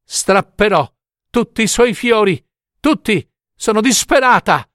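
high-pitched, desperate threat; desperate cry. A narrator's reading. Mother threatens Death with tearing up every flower, desperate. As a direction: cry it out in desperation, in short bursts. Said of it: "gridò alla morte".

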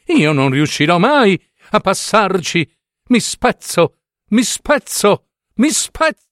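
mock panic building to a squeal; needle cries in panic. A narrator's reading. Needle in the slipper panics about breaking, rising wail. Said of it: "gridò l'ago da rammendo".

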